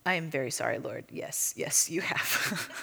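quiet, half-whispered, humbled; sheepish apology. Retold or imitated. Rebuked woman apologizing to the Lord, meek whisper. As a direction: shrink back and murmur sorry.

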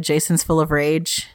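usual speaking voice

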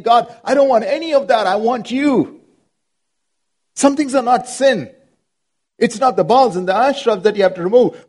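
high, pleading prayer to God; fervent prayer. Acted. Pastor praying aloud to God for the congregation, high and pleading. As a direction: lift it up as an earnest plea.

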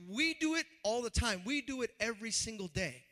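ordinary talk

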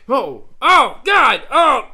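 shocked yell. Acted. Cohost startled on return, shouted.

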